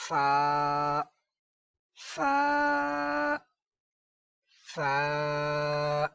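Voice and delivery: فا with a long held open "aa"; correct Arabic pronunciation. Shown as a demonstration. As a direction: chant فا slowly, stretched on one note. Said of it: "بلکہ".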